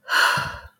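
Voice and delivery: a loud, breathy, shaky sigh; heavy sigh to steady herself. Real, not acted. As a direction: breathe out the tears. Collecting herself after tears.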